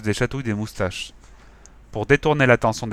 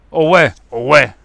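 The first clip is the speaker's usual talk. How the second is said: loud dim-witted agreement through gum; chewing gum; 3 semitones higher, 10 dB louder than usual. Acted. Moustachu backing his boss, chewing loudly. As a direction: chew it like a cow. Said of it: "en mâchant du chewing-gum comme une vache".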